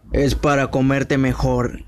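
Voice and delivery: plain reading voice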